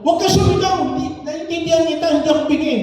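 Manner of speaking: mock indignant elder. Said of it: "pag sinigaw mo".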